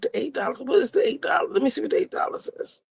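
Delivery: soft, fast, breathy mumble; mumbling as she checks the eight dollar tier; muttered to self. Real, not acted. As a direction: mutter it while reading the screen. Puzzling over tier.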